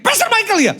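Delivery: sudden high-pitched cry of recognition; imitated excited yell. Woman spots the pastor in a restaurant, excited yell. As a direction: mimic a woman calling out, pitch leaping up. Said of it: "Tiba-tiba teriak".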